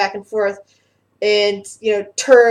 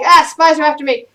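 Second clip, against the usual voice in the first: raised, higher cry of alarm; paranoid alarmed shout; 6 semitones higher, 7 dB louder than usual. Acted. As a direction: replay the mad mage's outcry. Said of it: "it's like".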